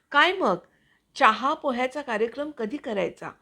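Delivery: teasing relative. Retold or imitated. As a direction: tease like a nosy aunt. Relatives teasing about a match-viewing visit. (चिडवणाऱ्या नातेवाईकाचा सूर)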